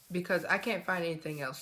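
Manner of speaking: usual speaking voice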